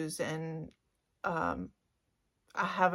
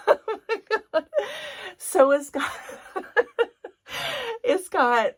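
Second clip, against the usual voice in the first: much higher, louder squealing with laughter; giddy delight; 13 semitones higher, 4 dB louder than usual. Real, not acted. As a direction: squeal over the gift. Unboxing gift, squealing with delight.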